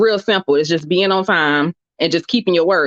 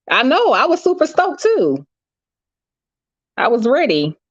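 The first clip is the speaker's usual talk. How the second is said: excited; 7 semitones higher than usual. Real, not acted. Gushing to chat about book.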